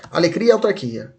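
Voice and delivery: A LEI CRIA blurred into ALEGRIA; quick, joined-up mnemonic; said fast. Shown as a demonstration. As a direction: run "alegria, autarquia" together quickly. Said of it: "Se você falar rápido, fica assim".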